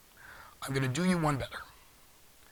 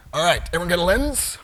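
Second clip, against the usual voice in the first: calling out to room; 4 semitones higher, 11 dB louder than usual. Real, not acted.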